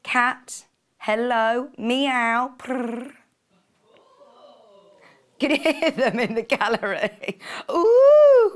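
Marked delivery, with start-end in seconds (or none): 1.8-3.1 a playful meow and a rolled purr; mock cat meow and purr. Host doing cat noises for the gallery, playful meow and purr. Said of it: "they're oohing at me, purring". 7.7-8.5 high, loud ooh; a drawn-out high ooh; ooh from the gallery. Real, not acted. Gallery crew oohing at the host's purr, high and loud. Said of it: "they're oohing at me".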